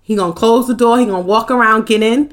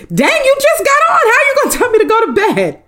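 very high, squeaky indignation; mock protest; 13 semitones higher than usual. Viewer sent to bed answering back, mock protest. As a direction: whine the complaint high with a laugh in it.

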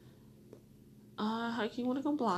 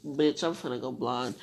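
ordinary talk

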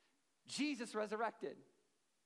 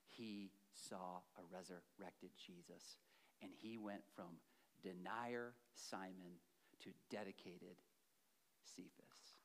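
lower, much softer and slow; hushed climax; 12 semitones lower, 12 dB quieter, slower than usual. Real, not acted. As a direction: let it fall to a near whisper.

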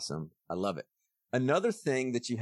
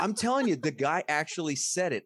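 insisting; 7 semitones higher than usual. Real, not acted. Insisting to disbelieving cohost.

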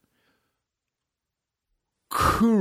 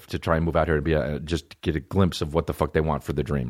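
ordinary delivery

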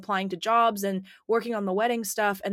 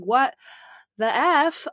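what the F; 5 semitones higher, 6 dB louder, much slower than usual. Retold or imitated. Shocked at covid pneumonia diagnosis.

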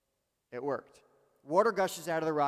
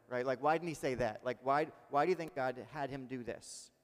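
normal talking voice

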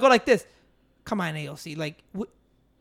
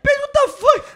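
incredulous yell; 8 dB louder, slower than usual. Retold or imitated. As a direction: shoot up high and loud in disbelief. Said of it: "I'm like".

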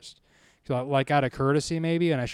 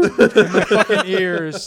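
usual speaking voice

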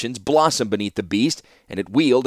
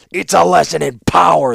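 loud, higher, forceful shout; shouted back; 7 semitones higher, 8 dB louder than usual. A narrator's reading. Warlord answering the outraged artificer, shouting. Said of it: "the warlord shouted in return".

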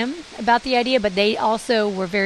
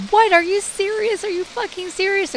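high-pitched stunned disbelief; incredulous, squeaky-high questioning; retold incredulity; 11 semitones higher than usual. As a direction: re-enact being floored, voice shooting way up. Retelling her shocked reaction to husband.

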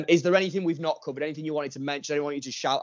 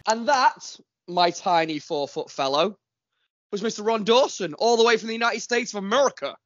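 hammed-up American accent, pitched much higher; a mock American announcer voice with a higher pitch; American accent impression; 9 semitones higher than usual. Host signing off the guest, in a mock American accent. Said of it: "I was doing an impression".

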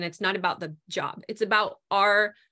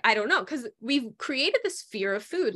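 usual speaking voice

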